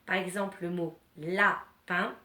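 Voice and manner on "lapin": "lapin" slowly split into two syllables. Shown as a demonstration. Teacher gives children an example word, syllable by syllable. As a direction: say "lapin" with a gap between la and pin. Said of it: "pour compter les syllabes d'un mot".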